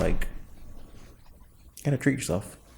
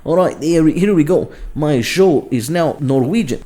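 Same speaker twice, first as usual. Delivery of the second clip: loud, higher Norwegian accent; 5 semitones higher, 7 dB louder than usual. Shown as a demonstration. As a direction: put on a hammed-up Norwegian accent. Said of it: "and they sound like this".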